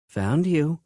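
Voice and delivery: bright, giggly and eager; eager giggle. A narrator's reading. Flirty woman sneaking up, eager and giggly. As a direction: pop out at him with a giggle. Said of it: "she said on an eager giggle".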